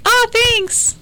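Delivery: high, airy mock "Oh, thanks"; mock surprised thanks. Telling friends about her party, mock surprised high thanks. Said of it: "and you're like".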